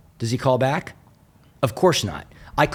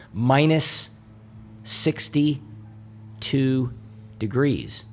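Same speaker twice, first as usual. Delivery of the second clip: drawn-out reveal; 4 dB quieter, much slower than usual. Acted. Speaker to the audience, dragging out the cold number. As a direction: let "sixty-two" hang, slow and heavy.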